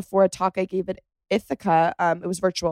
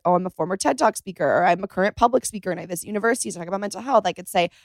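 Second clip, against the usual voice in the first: rapid rush of words, barely breathing; talking fast; faster than usual. Real, not acted. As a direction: rattle it off in one breathless rush. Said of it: "You're talking so fast".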